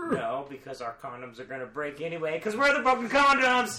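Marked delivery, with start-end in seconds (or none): 2.4-3.8 rally the table with a whoop; gleeful shout. Real, not acted. Podcast hosts cheering their own team name, loud. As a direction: yell it out, loud and high. Hyped drunk chant.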